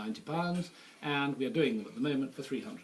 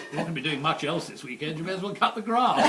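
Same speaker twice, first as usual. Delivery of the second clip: laughing; 3 semitones higher, 9 dB louder than usual. Real, not acted. Punchline while laughing.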